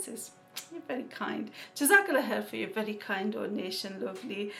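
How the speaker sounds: usual speaking voice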